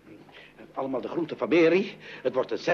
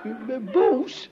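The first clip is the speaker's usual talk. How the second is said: surprised; 9 semitones higher, slower than usual. Acted. Father surprised by visitor on phone.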